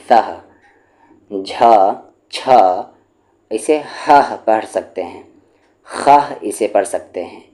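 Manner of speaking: each aspirated sound spoken alone with long pauses between; aspirated sound drill. Shown as a demonstration. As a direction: say every sound on its own, clearly aspirated. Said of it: "teacher demonstrates aspirated sounds with pauses".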